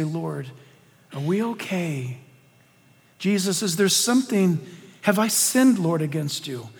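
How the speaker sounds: soft, low, tentative questions to God; hushed prayer. Acted. Believer on knees asks the Lord about sin, hushed prayer. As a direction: pray quietly, searching.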